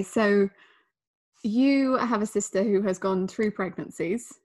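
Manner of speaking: usual speaking voice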